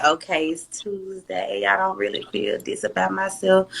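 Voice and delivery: soft, slow, deflated inner voice; doubtful, quiet self-talk. Retold or imitated. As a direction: mutter it like a down morning. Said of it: "I may wake up and be feeling like".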